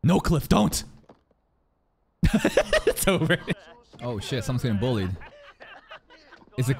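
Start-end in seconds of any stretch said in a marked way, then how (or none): 2.2-2.8 short squealing laugh; a quick high giggle burst. Real, not acted. Reacting as Cliff gets stopped, high giggle.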